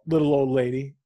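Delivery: ordinary talk, nothing marked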